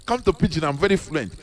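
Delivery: soft, distant voice of someone looking down on their own language; mocking snob. Mocking a Nigerian who boasts of English over the native tongue. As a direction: mimic a show-off who brags about speaking only English. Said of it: "some people are glamorizing it".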